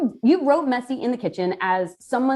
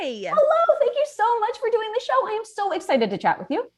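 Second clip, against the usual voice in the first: excited greeting; 11 semitones higher than usual. Real, not acted. Host greets guest warmly.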